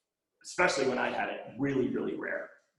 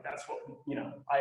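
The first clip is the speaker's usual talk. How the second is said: ordinary talk, nothing marked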